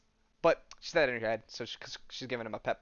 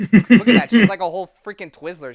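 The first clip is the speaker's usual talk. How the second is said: loud, high amazed shout; excited outburst; 8 semitones higher, 19 dB louder than usual. Real, not acted. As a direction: yell it out in sheer disbelief. Amazed reaction to the scene.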